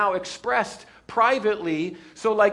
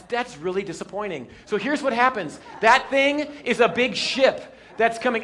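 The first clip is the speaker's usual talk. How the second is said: ordinary talk, nothing marked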